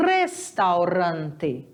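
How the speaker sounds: restaurante, slow, trilled R at the start; Spanish pronunciation. Shown as a demonstration. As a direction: say restaurante slowly with a vibrating R. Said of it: "O R sempre vai ser vibrante".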